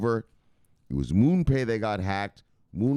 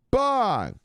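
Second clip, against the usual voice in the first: sign-off call; 13 semitones higher, 5 dB louder than usual. Acted.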